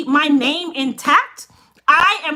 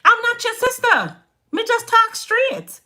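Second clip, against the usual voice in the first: put-on higher, slow Jamaican accent; Jamaican patois; 8 semitones higher than usual. Shows customers her Jamaican way of talking, higher and slow. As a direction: lean into the Jamaican accent and drag it out. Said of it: "Kingston Jamaican, and Montego Bay Jamaican".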